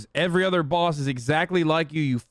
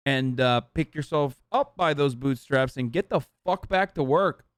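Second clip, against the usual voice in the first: ordinary talk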